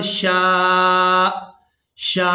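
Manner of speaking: शा cut off with a sharp glottal catch; hamza stop at the end. Shown as a demonstration. Teacher showing students the final hamza jolt. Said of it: "आखिर में झटका सा महसूस होगा".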